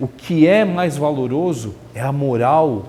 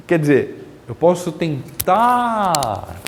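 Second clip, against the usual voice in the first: usual speaking voice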